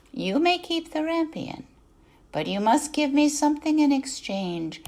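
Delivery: a witch sweetly driving her bargain, pitched high; high cackling-edged witch voice without the cackle; old witch. Acted. Old woman bargains with wife.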